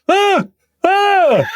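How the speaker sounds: high shrieking "Ah!" as Bill Bailey; mock shriek. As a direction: squeal the ahs high and loud. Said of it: "He goes".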